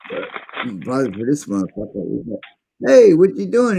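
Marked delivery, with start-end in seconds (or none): 2.9-3.8 loud, higher call of "Hey"; mock challenge to a stranger. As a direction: call out to stop him. John confronting the thief.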